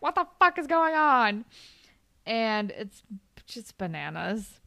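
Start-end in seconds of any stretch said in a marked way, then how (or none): 0.0-1.4 imitating an indignant girlfriend, higher and louder; a hammed-up shocked snap at the scene; mock angry outburst. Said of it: "Allie walks in and is like".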